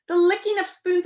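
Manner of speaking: ordinary delivery, nothing marked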